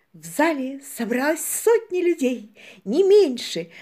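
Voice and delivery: put-on bright, eager girl's exclaim; excited exclaim. A narrator's reading. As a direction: gush it out excitedly. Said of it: "воскликнула Шарлотта".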